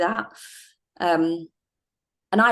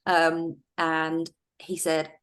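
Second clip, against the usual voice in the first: usual speaking voice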